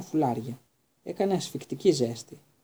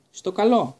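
high, loud, grand farewell; knight's farewell shout; 5 semitones higher, 6 dB louder, slower than usual. Acted. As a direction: fling out a hearty goodbye. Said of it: "φώναξε ο υπότης".

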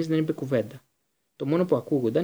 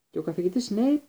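high, tentative question; a touch of hesitancy; faltering; 7 semitones higher than usual. A narrator's reading. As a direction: ask it timidly. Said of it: "ψέλισε η Ερμιόνη".

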